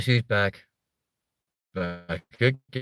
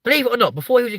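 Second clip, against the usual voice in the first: much higher, squeaky and louder; Joe Pasquale squeaky voice; 8 dB louder, much faster than usual. Retold or imitated. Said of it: "really high squeaky voice".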